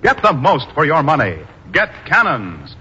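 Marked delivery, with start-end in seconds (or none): none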